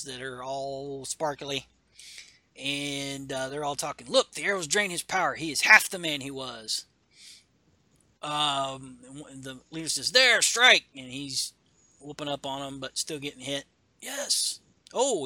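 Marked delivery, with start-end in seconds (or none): 4.1-6.9 gloating soldier. Acted. Enemies gloat in comic. 10.1-10.9 loud, high battle order; a leader calling the final blow; commanding shout. Acted. Leader orders attack in comic.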